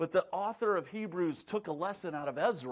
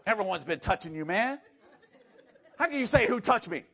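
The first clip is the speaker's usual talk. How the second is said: exasperated, louder, laughing disbelief; annoyed Peter; 3 semitones higher, 7 dB louder than usual. Retold or imitated. Peter annoyed at Jesus in the crowd, exasperated and laughing. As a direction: scoff at the question. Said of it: "Peter's a little annoyed".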